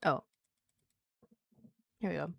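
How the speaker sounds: ordinary talk, nothing marked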